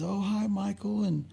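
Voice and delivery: softly imitating a wife delighted to see her husband; sweet wife greeting. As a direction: greet him like a happy, adoring wife. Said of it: "she says".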